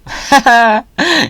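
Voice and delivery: bright high-pitched burst of laughter. Real, not acted. Delighted at the dapper snake, high laugh. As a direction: laugh high and free at the hat. Hats on dinos, amused.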